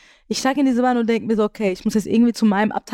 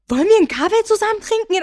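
much higher, fast, eager voice; high eager pickup line; 10 semitones higher, 3 dB louder, faster than usual. Retold or imitated. Salesman asking a stranger out for coffee, much higher and fast. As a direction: chirp the invitation up high and quick. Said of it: "Aber genau in dieser Tonlage".

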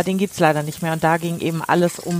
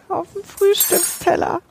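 mock indignant; 12 semitones higher, slower than usual. Mocking the small breakfast plates.

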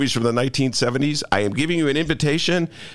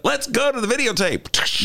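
high hammed-up anchor call; sportscaster catchphrase; 10 semitones higher than usual. Recalling a nineties sports guy, high announcer call. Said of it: "there was a guy who used to do that".

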